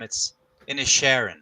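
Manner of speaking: Inisherin said the Irish way, in-ish-ERR-in; Irish pronunciation of Inisherin; Irish accent. Shown as a demonstration. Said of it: "if we're speaking like them".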